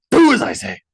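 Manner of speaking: loud high order; king silencing his son with an order; sharp command. A narrator's reading. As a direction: cut him off hard. King cuts off debate.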